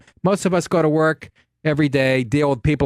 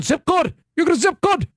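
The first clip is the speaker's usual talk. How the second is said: put-on much higher, insistent Nigerian accent; imitating the harried attendant's Nigerian accent; mock Nigerian accent; 11 semitones higher than usual. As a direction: shout zip code like the busy attendant. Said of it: "heavy Nigerian accent".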